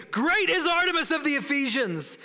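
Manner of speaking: high, forceful crowd chant; furious shout. Acted. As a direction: shout it like an enraged mob. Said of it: "were furious and began shouting".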